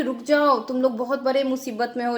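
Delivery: ordinary delivery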